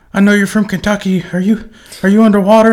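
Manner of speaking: high, fast mock-concerned caller; mock worried Marines. Marine Corps friends calling him, in a high mock-worried voice. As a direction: squeak it like a worried buddy. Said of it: "and they were like".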